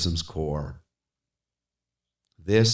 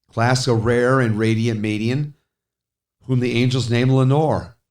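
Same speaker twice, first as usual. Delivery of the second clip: higher and louder, swelling; swelling plea; 5 semitones higher, 6 dB louder than usual. Acted. Speaker begs for news of Lenore, loud. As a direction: build it to a cry.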